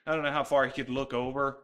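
ordinary talk, nothing marked

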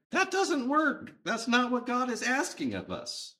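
high-pitched, insistent protest; firm objection, voice lifted high; emphatic refusal. Acted. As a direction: reject it outright, pitched well up. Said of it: "I would say an emphatic no".

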